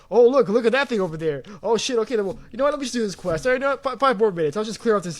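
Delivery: excited gamer. Retold or imitated. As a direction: get sidetracked by every shiny thing. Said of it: "'cause it's like".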